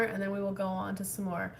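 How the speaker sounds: ordinary delivery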